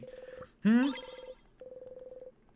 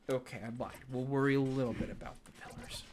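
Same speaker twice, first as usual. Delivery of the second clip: ordinary delivery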